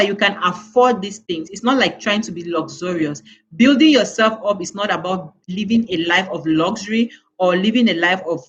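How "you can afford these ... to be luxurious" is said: usual speaking voice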